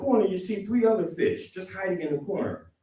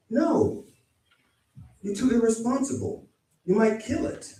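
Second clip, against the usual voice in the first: higher-pitched mock parent refusal; firm parental no, pitched up; parent saying no; 6 semitones higher, 3 dB quieter than usual. Parent telling a six-year-old no puppy, mock-scolding.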